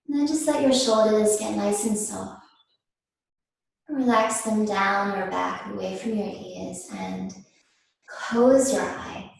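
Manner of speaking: slow, unhurried yoga teacher voice with long pauses; guided meditation. Real, not acted. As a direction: ease them gently into stillness, phrase by phrase. Leads seated breathing meditation.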